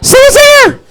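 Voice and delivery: very high squeaky panicked shriek; Mickey Mouse scream. Acted. Scared man screaming from the toilet, squeaky falsetto panic. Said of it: "I sound like Mickey Mouse".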